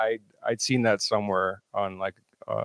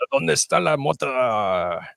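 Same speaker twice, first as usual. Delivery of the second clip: Spanish-speaking Shaggy; 4 semitones higher, slower than usual. Pretend. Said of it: "In Spa".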